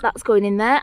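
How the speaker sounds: ordinary delivery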